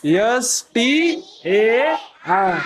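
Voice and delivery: very slow letter-by-letter spelling. Shown as a demonstration. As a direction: stretch every letter for the class. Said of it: "star".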